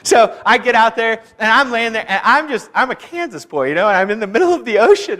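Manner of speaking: usual speaking voice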